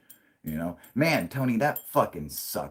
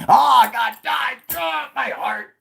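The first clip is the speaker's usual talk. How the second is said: much higher, loud pained cry; anguished outcry; 11 dB louder, slower than usual. Real, not acted. Hearing the girlfriend called him Proofy, anguished cry. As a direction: cry out as if heartbroken. Reacting to sad news, joking.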